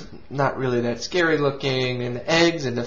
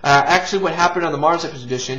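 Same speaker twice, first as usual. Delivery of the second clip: ordinary delivery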